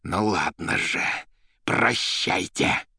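slow, drawn-out parting threat; wild defiance. A narrator's reading. Cornered poet about to bolt, wild defiance. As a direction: draw out a defiant farewell. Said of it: "дико и затравленно озираясь".

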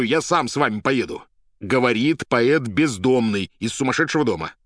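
loud, high, urgent orders; shouting. A narrator's reading. Poet orders police to send motorcycles, shouting. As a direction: bellow demands down the telephone. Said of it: "опять закричал в трубку".

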